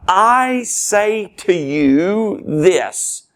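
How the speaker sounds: very slow, high, "I" held long and every word weighted; emphatic declaration. Acted. Preacher voicing Jesus declaring the will of God, emphatic. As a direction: proclaim it word by word from a drawn-out "I". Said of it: "he is declaring the definitive will".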